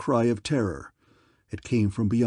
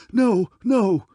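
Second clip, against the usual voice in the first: much higher and louder, a terrified shriek; cowardly king crying out in terror; panicked exclamation; 10 semitones higher, 6 dB louder than usual. Acted. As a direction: beg for your life in a sudden shriek. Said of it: "exclaimed Lord of Armon".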